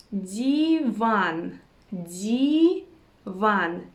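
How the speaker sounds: divan stretched long, dee, then vaan; syllable by syllable. Shown as a demonstration. Teacher teaching the word for sofa, slow syllables. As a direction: draw out both syllables of divan.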